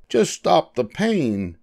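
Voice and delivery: high, slow pleading fox; mock whimper, stretched out. A narrator's reading. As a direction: plead in a high, pained character voice. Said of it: "whimpered the fox".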